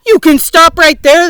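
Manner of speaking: loud, sharp, cutting tone; sharp warning. A narrator's reading. Earthworm sharply cutting off James, loud and angry. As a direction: snap it out to stop him. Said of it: "the earthworm said sharply".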